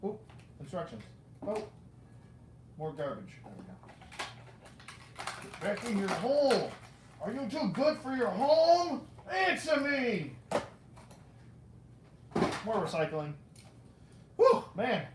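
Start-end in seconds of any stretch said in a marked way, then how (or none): 5.4-10.3 play-scold, rising to a demanding shout; mock scolding. Collector to a stubborn toy, mock scolding.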